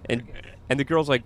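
usual speaking voice

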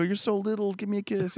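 retold high, softened cooing at a child; doting. Dad retelling how he fussed over costumed son, doting.

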